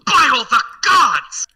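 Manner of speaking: high, loud, drawn-out shock; theatrical astonished outburst; stunned exclamation. Acted. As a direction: cry out aghast as a startled noble. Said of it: "he just kinda seems stunned".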